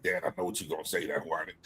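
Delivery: low, quiet, fast mumbled son voice; mock son whining. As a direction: mutter it like a bored teenager brushing dad off. Son voiced in retold talk.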